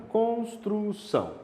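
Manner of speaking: "construção" slowly in three beats, cons-tru-ção; syllable by syllable. Shown as a demonstration. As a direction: stretch "construção" out, separating each of its syllables. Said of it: "Três sílabas".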